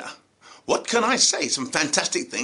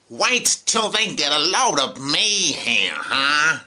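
celebrity quotes; 4 semitones lower, slower than usual. Retold or imitated. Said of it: "in the words of some of our celebrities".

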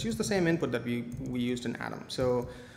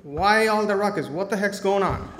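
put-on high, louder little-kid voice; a small child asking what the noise is about; toddler voice; 5 semitones higher, 5 dB louder than usual. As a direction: play the curious toddler, a bit giddy. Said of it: "and he's like".

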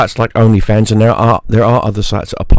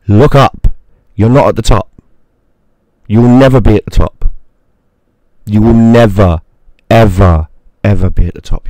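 louder, hammering each line home; loud rant; 8 dB louder than usual. Real, not acted. Vlogger yells at OnlyFans creators that they'll never be at the top. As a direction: yell it down at them.

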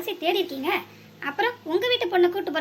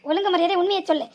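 ordinary talk, nothing marked

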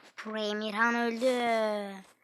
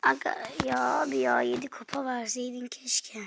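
soft unvoiced grumble; whispered lament; 5 dB quieter than usual. Real, not acted. Whispering regret about the trophies. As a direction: sigh it out under your breath.

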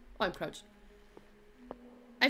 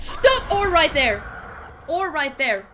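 much higher, loud yell; panicked shout; 3 semitones higher, 10 dB louder, slower than usual. Real, not acted. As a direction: yell at the monster. Panicked shouting at game monster.

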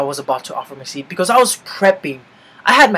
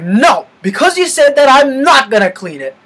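loud, high bratty retort to mom; indignant retold outburst, hammering the refusal; defiant kid's refusal; 8 semitones higher, 7 dB louder than usual. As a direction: act out a stubborn child yelling back. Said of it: "I'm like".

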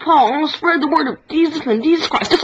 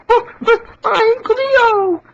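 put-on higher, slow girly character; cartoon girl voice; 5 semitones higher, slower than usual. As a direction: draw it out sweetly up high. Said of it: "One hundred impressions".

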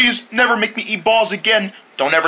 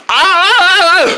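high, loud drawn-out scream; loud yell; 12 semitones higher, 6 dB louder than usual. Acted. Cries out while being bounced.

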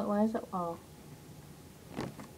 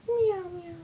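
playful meowing; 9 semitones higher, 4 dB quieter than usual. Pretend. Pretending to be a cat.

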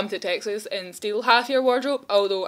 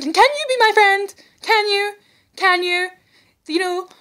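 eager pleading; 10 semitones higher, 5 dB louder than usual. Real, not acted. Begging a friend eagerly.

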